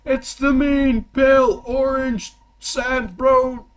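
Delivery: high, slow, loud fight intro; arena announcer. Acted. Game master introducing a fighter to the arena, booming announcer. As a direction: draw the name out for the crowd. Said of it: "this announcement, by the way".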